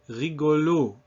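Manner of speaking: French rigolo, last O closed and stressed; stress on last syllable. Shown as a demonstration. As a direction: lean into the end of rigolo. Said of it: "stress of the word will land".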